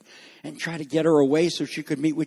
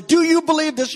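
pressing question; 12 semitones higher, 4 dB louder than usual. Acted. Acting Jesus questioning Martha.

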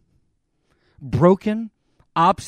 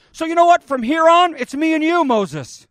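much higher and louder declaration; emphatic announcement; 7 semitones higher, 5 dB louder than usual. Acted. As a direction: announce it with a flourish. God tells Moses the plan.

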